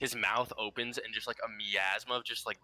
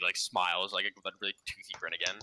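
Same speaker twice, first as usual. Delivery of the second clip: ordinary talk, nothing marked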